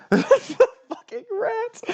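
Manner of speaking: high, slow, half-crying laugh. Real, not acted. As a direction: wail it out through laughter. GM echoes player's line, laughing.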